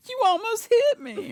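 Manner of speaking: put-on very high, loud, slow old-lady protest. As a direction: screech it slowly as an indignant old lady. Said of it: "This old lady was like".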